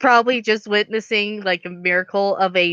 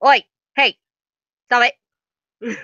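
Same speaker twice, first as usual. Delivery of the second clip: louder, higher call to attention; calling out sharply; 3 semitones higher, 4 dB louder than usual. Acted. Player snapping a vampire out of her fit, calling out. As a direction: cut in loudly to stop them.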